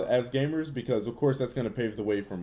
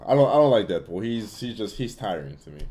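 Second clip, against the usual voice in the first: ordinary talk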